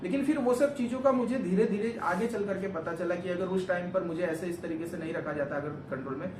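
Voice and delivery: normal talking voice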